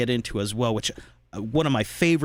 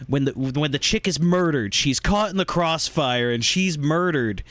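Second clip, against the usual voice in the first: ordinary delivery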